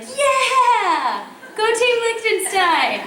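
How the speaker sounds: high, loud, drawn-out sports-fan cheer; excited cheer. Retold or imitated. Fan cheering tiny Liechtenstein at the Olympic opening ceremonies, excited cheer. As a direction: whoop it out, rooting for the team. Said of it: "I was like".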